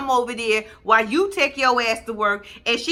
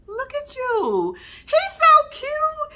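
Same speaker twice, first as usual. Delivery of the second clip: put-on high, slow baby-talk coo; 3 dB louder, much slower than usual. Cooing at the little boy, high and slow. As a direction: gush over a cute kid.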